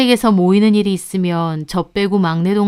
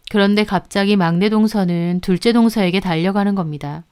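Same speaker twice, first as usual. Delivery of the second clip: plain reading voice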